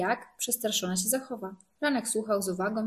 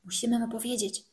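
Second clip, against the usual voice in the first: ordinary delivery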